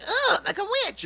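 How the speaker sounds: high witchy screech; cackling witch. Shown as a demonstration. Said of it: "try to sound like, like a witch".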